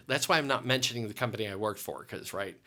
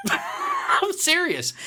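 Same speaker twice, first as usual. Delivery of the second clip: very high laugh into the words; 7 dB louder, much slower than usual. Real, not acted. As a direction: laugh it out, slowly. Laughing while recalling coaching.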